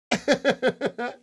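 short, high burst of chuckles; real laugh. Real, not acted. Streamer amused by his chokehold tale, high chuckle. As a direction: break into a quick high laugh.

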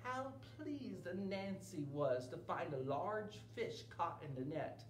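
ordinary delivery

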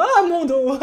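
high laughing mock back pain; laughing groan. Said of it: "je le dis tous les jours".

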